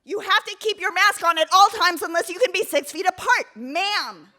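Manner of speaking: high, loud, scolding voice; mocking imitation of a preachy hiker; bossy scolding stranger. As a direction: lecture her like a self-appointed rule enforcer. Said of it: "and I hear".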